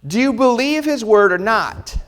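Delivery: normal talking voice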